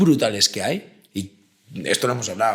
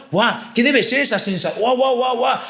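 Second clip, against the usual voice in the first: put-on child's wonder; 9 semitones higher than usual.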